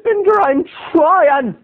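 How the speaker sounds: high, slow whimpering; hammed-up sobbing plea; mock whining cry. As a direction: blubber pathetically. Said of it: "I said".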